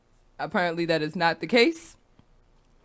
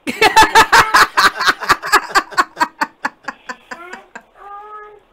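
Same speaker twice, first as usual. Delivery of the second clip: high, loud burst of laughing; loud laughter; 12 semitones higher, 13 dB louder than usual. Real, not acted. Host cracking up at a yelling caller, loud laughter.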